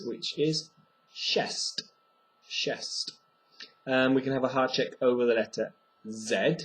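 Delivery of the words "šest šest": šest said twice with sh at the start; Czech šest. Shown as a demonstration. Teacher modelling the Czech word for six. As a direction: say chest as sh-est. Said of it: "the number six".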